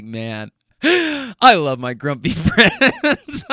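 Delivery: slow words broken up by laughter; laughing fondly. Retold or imitated. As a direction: laugh your way through it. Laughing fondly at own thought.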